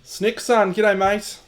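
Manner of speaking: high, loud, cheery g'day; hearty greeting. Real, not acted. Greeting a viewer named in chat, loud and cheery. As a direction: call out a big welcome across the room.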